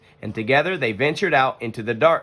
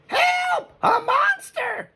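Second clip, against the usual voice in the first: pretend-scared cry, much higher and slow; mock fright; much slower than usual. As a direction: ham up being frightened by a monster. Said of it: "pretending to be frightened".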